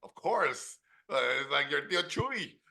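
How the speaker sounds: put-on quick-witted dad with a Mexican accent. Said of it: "he goes".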